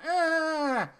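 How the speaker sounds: high, drawn-out "ah" of doubt; unimpressed groan. Acted. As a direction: let out a long, high noncommittal whine. Voices lukewarm verdict on film.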